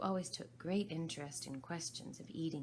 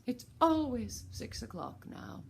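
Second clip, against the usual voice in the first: mournful; 8 semitones higher, 8 dB louder than usual. Acted. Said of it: "in a mournful tone".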